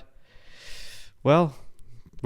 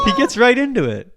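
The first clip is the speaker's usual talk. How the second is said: very high, loud howl of laughter; screaming laugh; 12 semitones higher, 8 dB louder than usual. Real, not acted. Laughing hard at the video.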